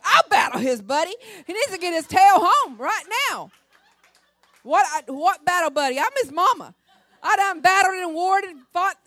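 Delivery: loud, high indignant ranting; a mother blowing up at the news; furious mama. Retold or imitated. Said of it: "Oh, I was mad".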